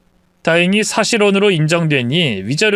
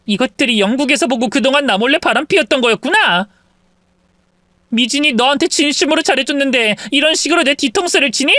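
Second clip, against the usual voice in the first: indignant wife, a touch higher; betrayed wife; 9 semitones higher than usual. Acted. Wife realizing the mistress is Mijin, indignant. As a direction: let the betrayal sting through.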